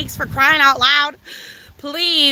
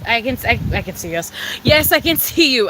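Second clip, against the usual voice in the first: ordinary talk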